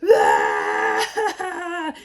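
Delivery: loud, high mock wail; hammed-up burst of loud crying; mock bawling. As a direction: wail like an imitation of uncontrollable sobbing. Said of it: "I'm talking like".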